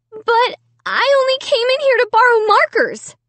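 high, trembling plea. A narrator's reading. Amy pleading with her family, trembling high voice. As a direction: let the voice shake. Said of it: "I cried in a trembling voice".

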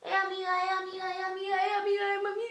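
high sing-song calling; robber calling out. Retold or imitated. The robber calling after her, high sing-song. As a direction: drag out amiga like a street junkie. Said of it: "Me está gritando".

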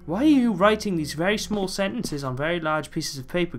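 ordinary talk, nothing marked

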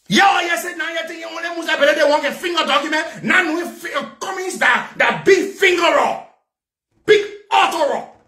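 high-pitched, indignant outburst; angry rant. Real, not acted. Ranting about who changed the document, angry rant.

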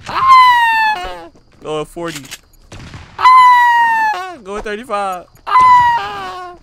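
panicked screams between callouts; yelling in gaming panic; panicking gamer. Real, not acted.

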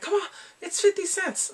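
high-pitched coaxing haggle; playfully exasperated wheedling; mock pleading. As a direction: plead it high, like bargaining over pennies. Acts out haggling over price.

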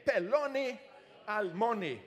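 "Peloni almoni", peh-LO-nee al-MO-nee, clear and steady; Hebrew pronunciation again. Shown as a demonstration.

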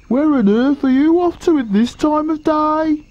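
high-pitched, enquiring hippo voice; high, nosy hippo character; Humpy Rumpy. A narrator's reading. Hippo greets the crocodile.

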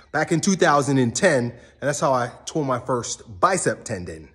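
usual speaking voice

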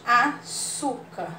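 Açúcar slowly, ç as a clear s; slow spelling demo. Shown as a demonstration. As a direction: stretch Açúcar, crisp s for ç. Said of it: "o C fez som de S". (Açúcar bem devagar, ç com som de s)

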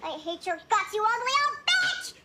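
low hateful growing outburst; seething, building anger. Acted. Restrained child answers the therapist, seething and building. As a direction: start low, climb sharply into anger. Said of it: "I can't hear you".

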